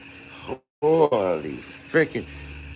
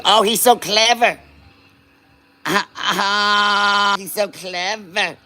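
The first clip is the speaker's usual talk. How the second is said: higher, slow, gushing fan; admiring onlooker voice; 7 semitones higher, much slower than usual. Pretend. Streamer voicing an impressed fan praising his work, mock gushing. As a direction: swoon over the glassblower's trick. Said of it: "I got another voice goes".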